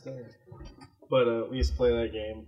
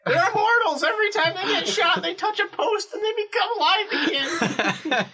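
much higher, giggling through the joke; laughing. Real, not acted. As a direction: crack up while you spin the joke. Joking about immortal players.

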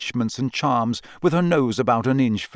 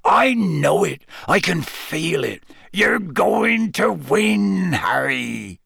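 Hagrid's growl; 5 semitones higher, much slower than usual. A narrator's reading. Hagrid reassuring Harry by the lake, slow rumbling growl. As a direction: growl it warmly like a big gamekeeper. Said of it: "Hagrid growled".

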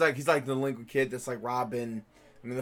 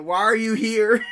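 slow, louder, mock fed-up question; mock exasperated; 6 semitones higher, 6 dB louder, slower than usual. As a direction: ask it like you're sick of them. Mock annoyance at love interests.

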